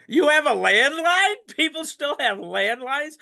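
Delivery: high, loud mock disbelief; incredulous surprise. Young sponsee teasing the old-timer, high astonished squeal. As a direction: squeal it like it's unheard of.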